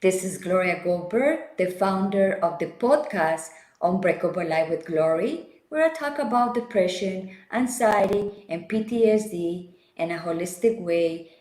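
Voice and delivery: ordinary talk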